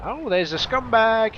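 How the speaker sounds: sudden high "oh" at a spotted enemy; surprised. Real, not acted. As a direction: react with a jolt of surprise. Spots an enemy tank.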